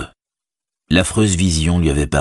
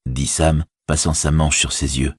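ordinary delivery

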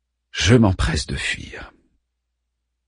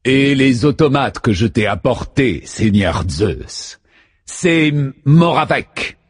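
resounding voice; 2 semitones higher, 3 dB louder, slower than usual. A narrator's reading. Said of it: "dont la voix résonne".

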